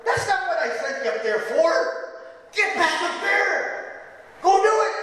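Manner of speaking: high, furious orders; angry king. Acted. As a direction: order him back up, seething. King orders Balaam back.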